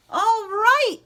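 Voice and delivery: high, loud exclamation; a loud, bright mayoral cry; mayor's cry. Acted. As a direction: burst out with it like a pleased mayor. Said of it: "cried the mayor".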